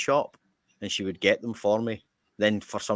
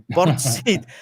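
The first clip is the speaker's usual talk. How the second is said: exasperated repeat; 6 semitones higher, 6 dB louder than usual. Retold or imitated. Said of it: "So I go".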